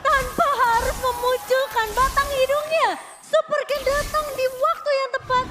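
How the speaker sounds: high, loud, thrilled caster; excited shout. Real, not acted. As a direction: cry out in disbelief. Co-caster reacts to steal.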